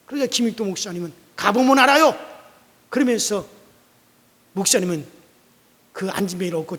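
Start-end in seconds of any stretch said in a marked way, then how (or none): 1.4-2.2 higher, much louder curt answer; firm loud reply. Acted. Pastor answering the protesting lame man, loud and firm. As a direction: shut the question down.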